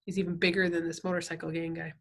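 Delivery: plain reading voice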